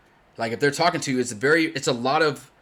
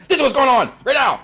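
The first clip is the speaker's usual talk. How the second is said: curt and much higher, louder; blunt, pushed harder; 12 semitones higher, 9 dB louder, faster than usual. Shown as a demonstration. Streamer switching to the next person, sharper blunt. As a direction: snap the facts out impatiently. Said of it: "like, blunt".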